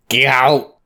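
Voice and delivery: broad fake Scottish accent; comic Scottish ogre; swamp accent. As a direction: fire back in the mock Scottish swamp accent. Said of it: "your swamp accent".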